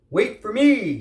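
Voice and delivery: high, loud shout. Acted. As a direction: shout after the others. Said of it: "shouted Armadillo".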